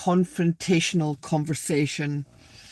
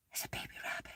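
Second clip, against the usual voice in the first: awed whisper; 22 dB quieter, much faster than usual. Real, not acted. Woman spots a baby rabbit, real whisper. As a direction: whisper it so as not to startle it.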